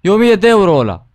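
man yelling at police that his sombrero was stolen; indignant protest. Acted. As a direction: protest loudly to the cop about the hat. Outraged over stolen sombrero.

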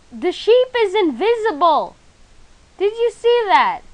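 high startled yell; shocked shout. Real, not acted. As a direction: cry out in disbelief. Shocked at invisible sheep.

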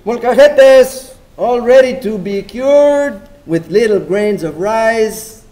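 loud, high hawker cry; vendor calling out. Acted. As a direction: announce the wares to the street.

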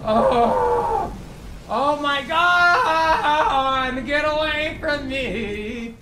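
long, high, stretched-out wailing; slow, drawn-out high cry of distress; panicked wail. Real, not acted. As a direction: wail and plead to be left alone. Fleeing an attacker in game.

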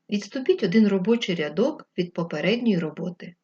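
ordinary talk

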